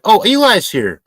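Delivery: light up as Eli arrives; excited greeting. Real, not acted. Greeting Eli who just joined the stream, excited.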